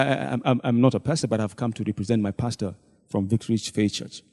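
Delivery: normal talking voice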